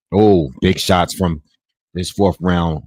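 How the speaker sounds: loud, slow burst of excitement; excited. Real, not acted. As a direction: call the big shots out loud. Big punches land in the fight.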